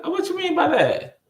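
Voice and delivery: very high, incredulous question; replaying a confused demand to explain; baffled questioning. Worker asks the teen to explain, baffled questioning. Acts out questioning her surprising reply.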